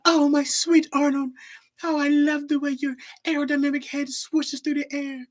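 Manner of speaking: high, swooning cartoon lovesick girl; hammed-up lovestruck squeal; Helga. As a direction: gush adoringly over a secret crush. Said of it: "Helga ... the way she'd act when Arnold was around".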